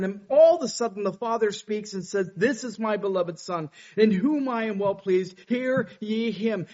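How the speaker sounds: fiery pulpit preaching; preaching at full force. Acted. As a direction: preach it out to the whole room. Preacher voicing God's declaration.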